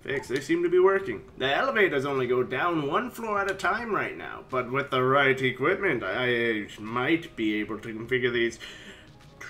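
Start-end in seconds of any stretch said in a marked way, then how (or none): none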